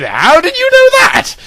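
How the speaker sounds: squeaky high, astonished question; surprised question. Acted. Lorna asking Legolas in sudden astonishment. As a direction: ask in disbelief.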